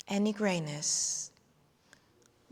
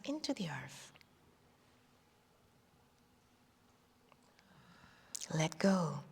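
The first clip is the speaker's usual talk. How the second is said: whispering; 3 semitones lower, 6 dB quieter than usual. Acted. Releasing into earth, voice fades.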